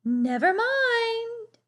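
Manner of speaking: put-on high, slow, sweet old lady voice; Cousin Clara. A narrator's reading. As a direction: coo it slowly like a doting aunt. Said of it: "said Cousin Clara".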